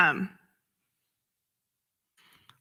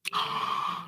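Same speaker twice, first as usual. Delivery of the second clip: soft gasp; 8 dB quieter than usual. Real, not acted.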